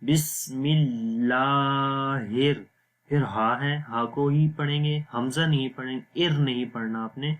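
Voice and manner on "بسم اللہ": slow بسم اللہ, holding 'laa' long; lengthened laam. Shown as a demonstration. Teacher showing the standing zabar, 'laa' in اللہ drawn out. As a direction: stretch the thin 'laa' in اللہ. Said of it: "اس کو لمبا کریں گے".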